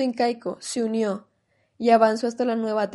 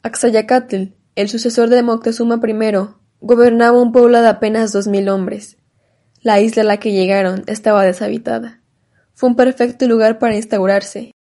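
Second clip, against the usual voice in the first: louder, booming documentary narration; dramatic narration; 8 dB louder than usual. A narrator's reading. Narrator tells the empire's fate, loud dramatic narration. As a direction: announce the empire's fall, loudly.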